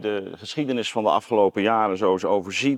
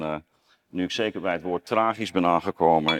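ordinary delivery

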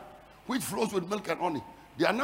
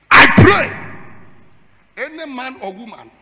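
roaring, higher prayer declaration; shouted prayer; 6 semitones higher, 20 dB louder than usual. Real, not acted. Preacher praying aloud over the church, roaring. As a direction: roar the prayer over the congregation. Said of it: "I said".

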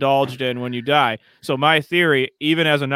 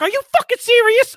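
high-pitched, louder outraged retelling; incazzato e deluso; 5 dB louder than usual. Retold or imitated. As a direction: imitate a pissed-off dealer, voice shooting way up. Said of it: "so fucking pissed off slash, like, disappointed".

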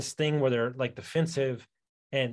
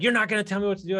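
higher, indignant mock protest; retold defiance; 7 semitones higher, 4 dB louder, much faster than usual. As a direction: imitate a drinker pushing back, pitched up. Retold defiant drinker in argument.

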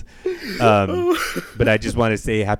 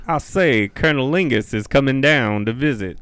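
Foghorn Leghorn; 3 semitones higher than usual. Pretend. Co-host doing Savannah, comic put-on Southern accent. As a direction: play up a broad Southern accent. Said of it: "Savannah's like Foghorn Leghorn".

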